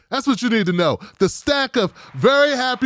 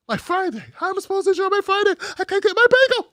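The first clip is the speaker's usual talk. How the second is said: much higher, fast, whining voice; high-pitched mock tantrum; whiny spoiled complaint; 12 semitones higher, faster than usual. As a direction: whine like a spoiled child denied a treat. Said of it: "repeated as whine".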